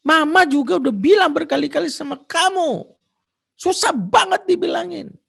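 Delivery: high, loud mock mother's scolding; exasperated mother telling her son off. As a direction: nag like a fed-up mom. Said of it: "ibunya mengatakan kalimat".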